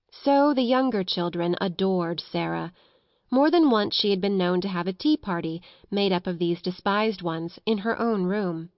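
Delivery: ordinary delivery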